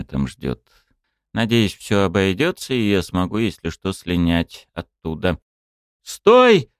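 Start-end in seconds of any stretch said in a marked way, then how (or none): 6.0-6.7 high, loud cry of stop; breathless shout. A narrator's reading. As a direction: call out, winded from running. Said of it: "запыхавшись, прокричала какая-то девушка".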